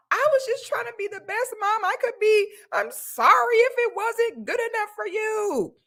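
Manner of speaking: high, whiny put-on sob voice; fake crying mother. Mother fake-crying to her child, high and whiny. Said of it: "they'll do anything but apo".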